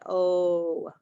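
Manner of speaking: a drawn-out "oh" for O; O said as "ohhh"; long O sound. Shown as a demonstration. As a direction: hold O as a long vowel. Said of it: "long O sound".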